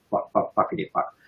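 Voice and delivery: whispered, sing-along swearing under the breath; playful whisper. Shown as a demonstration. Sprinkling extra swearing for the offended, playfully whispered. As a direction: drop to a cheeky whisper.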